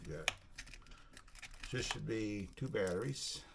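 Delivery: ordinary delivery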